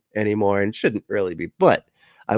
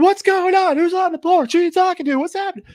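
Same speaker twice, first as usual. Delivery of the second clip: high-pitched, loud, alarmed questioning; Nikki; 6 dB louder than usual. Retold or imitated. Startled housemate coming out after a loud TikTok, mock alarm. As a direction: imitate someone frantic, firing questions in a shrieky high voice. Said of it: "then Nikki comes out and goes".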